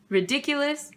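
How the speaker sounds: ordinary delivery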